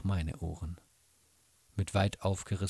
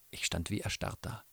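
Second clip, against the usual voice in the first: plain reading voice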